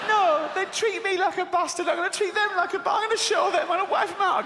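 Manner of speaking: high, fast petulant tantrum voice; hissy fit. Retold or imitated. [name]'s version of God, as a high-pitched hissy fit. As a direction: throw a high-pitched strop. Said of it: "having a hissy fit".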